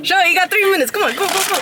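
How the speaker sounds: loud, high hurry-up; loud urging. Real, not acted. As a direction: hurry her along loudly. Urging friend on camera.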